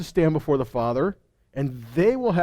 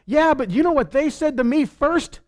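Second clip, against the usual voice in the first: whiny excuse; 9 semitones higher than usual. Retold or imitated. Excuse of an offended believer.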